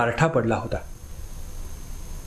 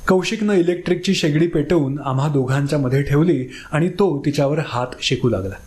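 ordinary delivery

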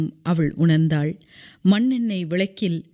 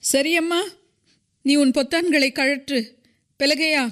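much higher-pitched woman's voice; high-voiced Maria, addressing the mother; gentle instruction; 8 semitones higher than usual. A narrator's reading. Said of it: "தாயிடம் மெதுவாக கூறினாள்".

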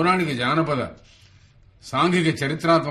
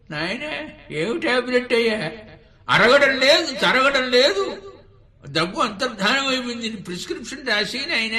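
put-on high-pitched, insistent old man; 11 semitones higher, 4 dB louder than usual. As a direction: plead in a high, stretched old man's voice. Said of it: "ఒక వృద్ధుడు బస్సులో వెళుతున్నాడు". (ఎత్తైన గొంతుతో ముసలి తాత మాట)

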